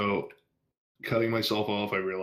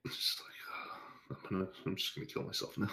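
whispered, deadpan despair, quick on the second line; mock despairing whisper; 7 dB quieter than usual. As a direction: breathe it out like giving up.

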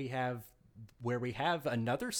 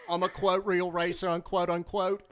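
mocking voice; 7 semitones higher, 5 dB louder than usual. Mocking a pompous driver.

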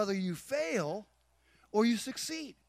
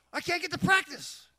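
high-pitched mock complaint; hammed-up plea, pitched way up; protesting son; 9 semitones higher, 3 dB louder than usual. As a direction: imitate a kid whining an excuse. Son protesting being cut off.

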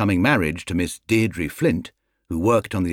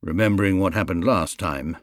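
plain reading voice

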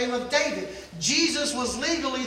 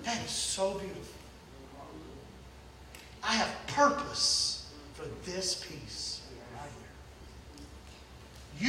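tender whisper; 9 semitones lower, 11 dB quieter than usual. Acted. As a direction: lean in and whisper it gently. God voiced tenderly.

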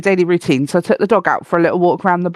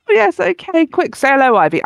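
much higher, hurried urging of the dog; flustered high voice; 7 semitones higher than usual. Retold or imitated. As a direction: nervously rush the dog to greet. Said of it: "I was a bit like".

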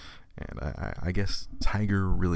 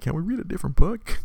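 weary plea; 11 semitones higher, 4 dB louder than usual. Retold or imitated. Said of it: "I'm like".

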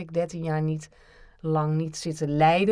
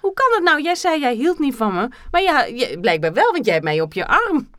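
excited; 7 semitones higher, 4 dB louder than usual. Pretend. Role-play confrontation.

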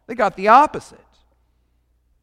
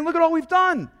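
much higher, whiny self-righteous complaint; indignant Peter; 12 semitones higher than usual. Retold or imitated. Peter protesting to Jesus, much higher and whiny. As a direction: pitch it up like a wounded apostle. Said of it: "he's saying".

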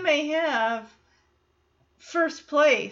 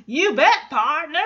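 mock Texan cowboy voice; Teddy's Texan quip; 3 semitones higher, 7 dB louder than usual. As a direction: punch it out like a cartoon Texas cowboy. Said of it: "Teddy spouting these non-Texas quips".

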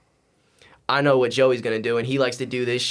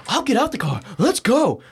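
challenging yell; 10 semitones higher than usual. Retold or imitated. Brother daring him to get out, challenging. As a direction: call him out to fight, voice pitched up.

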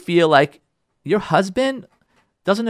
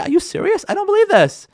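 much higher, indignant disbelief; outraged disbelief. Acted. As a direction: shoot way up in pitch, can't believe it. Wife retorts in acted quarrel.

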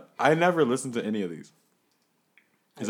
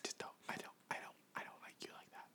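whisper; 23 dB quieter, faster than usual. Acted. Teasing whispered banter.

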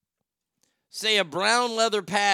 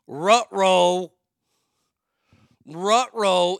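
loud, drawn-out "ruh-roh"; mock alarm like a cartoon dog; goofy dog-speak catchphrase; 7 dB louder, much slower than usual.

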